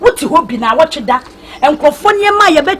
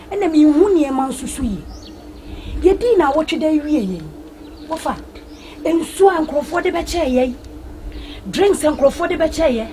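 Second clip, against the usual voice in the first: usual speaking voice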